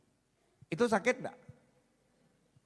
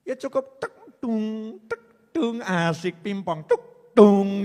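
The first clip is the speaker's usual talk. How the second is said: playful sound effect; 7 semitones higher, 10 dB louder, slower than usual. Acted. Imitating words bouncing off.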